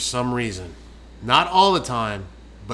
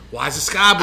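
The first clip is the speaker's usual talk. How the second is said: Joe Dirt movie quote; 3 semitones higher, 5 dB louder, faster than usual. Acted. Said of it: "that's from Joe Dirt".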